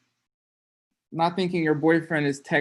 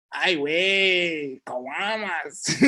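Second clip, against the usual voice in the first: put-on a light Guadalajara Spanish accent, last word slow and laughing; Tapatio accent; 2 semitones higher, much slower than usual. Teacher jokes about the Spanish the Sangha taught him, Tapatio accent. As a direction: toss it off in a hint of a Tapatio accent. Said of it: "With my Tapatio accent".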